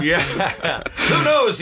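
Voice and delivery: high laughing yeah. Real, not acted. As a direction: laugh through the words. Laughing after joke.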